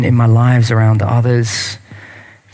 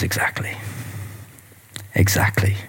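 a soft, pointed whisper; a quiet, knowing whisper to the crowd; hushed whisper; 5 semitones lower, 10 dB quieter than usual. Acted. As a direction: lean in and whisper the verdict. Jesus's punchline delivered hushed.

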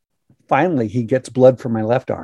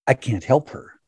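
ordinary talk, nothing marked

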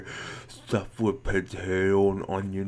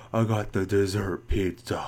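normal talking voice

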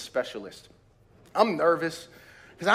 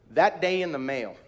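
usual speaking voice

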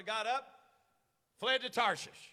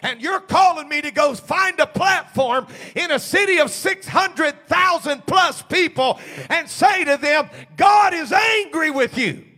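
loud, slow, driving sermon shout; full-force preaching; 6 semitones higher, 14 dB louder, slower than usual. Acted. Preacher shouting to his congregation, full force. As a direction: hammer the message home to the church.

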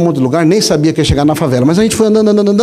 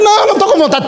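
high, laughing whiny voice; mock reluctant refusal; faster than usual. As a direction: whine a refusal like a stubborn kid. Man refusing to go to service.

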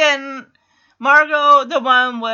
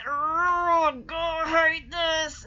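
mock whining; 5 dB quieter, much slower than usual. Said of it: "And Punky's like".

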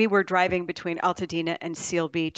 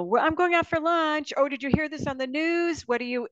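imitated family chatter; 8 semitones higher than usual. Mimicking family interrupting.

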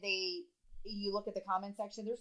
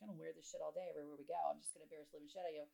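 quoting husband; 5 semitones lower, 12 dB quieter, much faster than usual. Retold or imitated. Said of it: "He was like".

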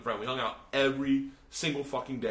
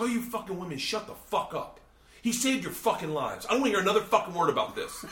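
high-pitched telling-off, louder toward the end; acted angry rebuke; 7 semitones higher, 3 dB louder than usual. Igor scolding the class in defence of his friend, acted angry rebuke. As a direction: lay down the law, then rush the last warning.

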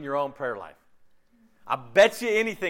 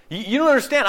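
louder mock protest; 8 semitones higher, 9 dB louder, faster than usual. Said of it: "we're going, 'God".

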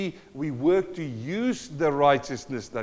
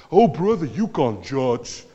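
lightly voiced whiny bystander, slow at the end; a hint of a pious complainer drawing it out; mock bystander; 6 dB louder, slower than usual. Preacher mocking critics of John, lightly voiced mock complaint. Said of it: "people standing around and saying".

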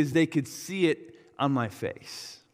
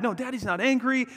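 reassuring dad; 8 semitones higher than usual. Retold or imitated. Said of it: "I kept reassuring her".

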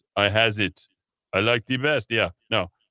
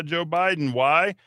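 ordinary delivery

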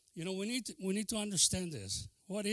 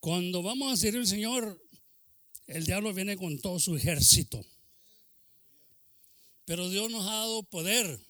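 loud, driving pulpit delivery; fiery preaching; 7 dB louder than usual. Acted. Preacher rousing the congregation against the devil, loud preaching. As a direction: preach it out full force to the church.

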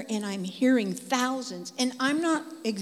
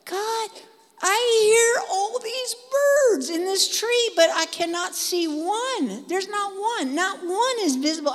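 higher, louder wonder at God; amazed prayer; 10 semitones higher, 6 dB louder than usual. Retold or imitated. Praying to God under the tree, amazed, higher and louder. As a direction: marvel aloud to God. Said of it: "I'm talking with the Lord".

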